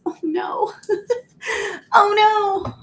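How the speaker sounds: high laughing oh no; half-laughing, half-wailing at the stuck bottle; laughing in dismay. Real, not acted. Bottle won't open, laughing.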